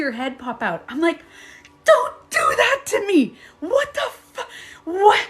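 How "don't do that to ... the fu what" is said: high, louder cry at daughter; frightened shout. Retold or imitated. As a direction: yell out of leftover fright. Scolding daughter after fright.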